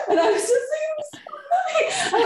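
high, laughing, near tears of joy; tearful joyful gasp. Retold or imitated. Overwhelmed mother answering the kind woman, high tearful laugh. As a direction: gasp and squeal through happy tears. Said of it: "almost started to cry tears of joy".

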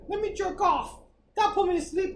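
high-pitched imitation of inner voice; hopeful self-talk. Sleepless man bargaining with himself, high mock self-talk. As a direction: act out a bright idea up high.

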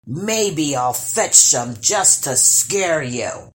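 low, slow, loud troll boasting; scoffing troll. Acted. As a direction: laugh off her fear like a greedy troll. Troll mocks her.

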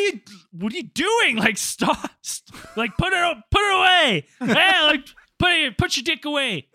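high, loud, shocked scolding; retold yell. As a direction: bark it at a kid caught in the act. Retold telling off a student.

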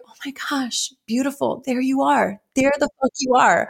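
tender discovery of your own voice; hushed and high, emotional; emotional self-greeting. Retold or imitated. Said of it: "I'm getting emotional about it".

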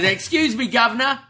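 high-pitched mock British politeness; mock British accent. R-Truth making fun of Waller, mock British accent. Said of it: "by mocking the British accent".

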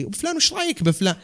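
high, fast teasing jab; teasing joker. Retold or imitated. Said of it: "يجي الظريف اللي". (نبرة الظريف الساخرة بصوت حاد)

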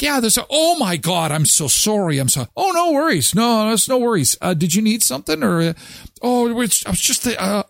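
high, flustered, stammering apology; flustered housekeeper. Retold or imitated. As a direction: babble apologies after barging in.

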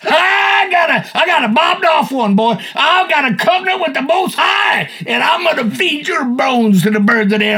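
rally yourself, half shouting, half crying; David's defiance. Retold or imitated. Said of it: "David was going".

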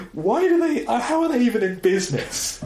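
incredulous disbelief. Real, not acted. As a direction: scoff in high disbelief that they survive. Mocking disbelief at the sandwich shop.

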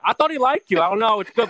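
high, loud mob guy chatter; Joe Pesci impression. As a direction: put on a hammed-up wiseguy voice. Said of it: "and he's like".

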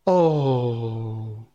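long, drawn-out falling moan. A narrator's reading. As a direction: hold the moan and let it sink. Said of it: "they all moaned".

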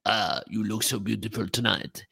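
lightly voiced French lover with rolled r; a hint of a cartoon French romantic, rolling the r; French skunk accent. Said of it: "Pepe Le Pew".

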